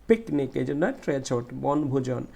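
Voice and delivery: usual speaking voice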